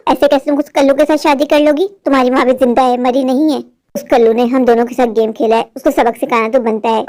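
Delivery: low, scheming mother muttering revenge; a plotting mother, voice pitched low. Acted.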